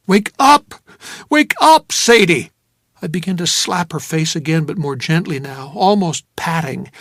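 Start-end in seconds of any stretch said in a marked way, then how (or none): 0.1-2.5 loud, high, desperate calls; frantic yell to rouse a drugged woman; urgent shouting. Acted. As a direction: shout her awake. Narrator shouts to rouse her.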